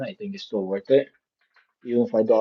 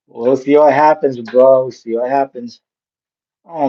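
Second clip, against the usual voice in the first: loud excited; 4 semitones higher, 12 dB louder than usual. Real, not acted. Suddenly much louder, higher voice.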